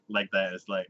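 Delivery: ordinary delivery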